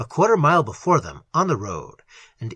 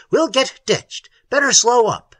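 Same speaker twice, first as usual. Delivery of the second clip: warning call; 8 semitones higher, 5 dB louder than usual. A narrator's reading. Algernon cautioning Little as the car careens, calling warningly. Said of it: "Algernon called out warningly".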